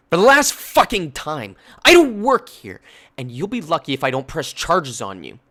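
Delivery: furious shout at the customer; furious yell back. Retold or imitated. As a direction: shout your defence in pain. Snapping back at abusive customer.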